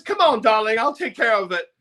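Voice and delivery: high, coaxing camp voice; campy promoter. Retold or imitated. Promo man calming the panicked singer, high and coaxing. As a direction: soothe him like a flamboyant handler.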